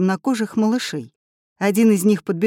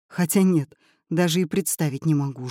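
ordinary delivery, nothing marked

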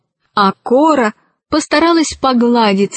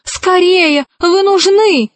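high, pressing call; urgent, raised-pitch summons; urgent cry; 8 semitones higher, slower than usual. A narrator's reading. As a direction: cry out for her to hurry. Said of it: "закричала она".

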